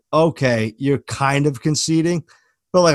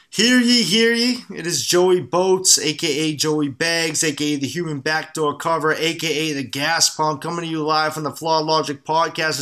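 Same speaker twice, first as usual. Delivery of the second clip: town crier. Acted. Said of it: "obnoxious ad read right in the middle".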